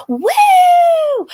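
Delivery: put-on high, loud drunk party woo; giddy party girl. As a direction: squeal like a tipsy clubgoer. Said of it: "the drunk girl at the club".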